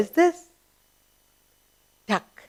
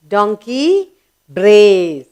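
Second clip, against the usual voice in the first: "brays" stretched long and loud; slow teaching call, long vowel on "brays"; drawn-out brays; 6 dB louder, much slower than usual. Shown as a demonstration. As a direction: swell loudly on a slow "brays". Class recap of the donkey's sound word.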